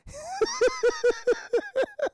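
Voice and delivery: high, manic villain laugh; Joker cackle. Acted. Heard in the woods behind Charlie, maniacal cackling. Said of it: "The Joker is right by".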